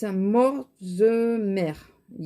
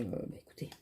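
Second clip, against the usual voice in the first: whisper; 10 semitones lower, 16 dB quieter, faster than usual. Real, not acted. Vlogger whispering an aside to viewers. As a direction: drop into a breathless whisper.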